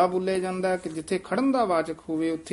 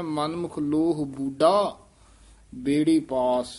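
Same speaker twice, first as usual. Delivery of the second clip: ਬੂਡਾ lifted and held with a small halt after; stress on ਬੂਡਾ; slower than usual. Shown as a demonstration. Teacher marks the beat on ਬੂਡਾ, stress then a pause. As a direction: lean on ਬੂਡਾ and pause before ਬੇੜੀ. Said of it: "ਬੂਡਾ ਤੇ ਠਮਕ ਲਾ ਦੇਣੀ ਹੈ".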